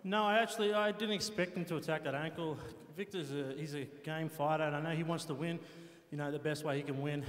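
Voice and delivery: normal talking voice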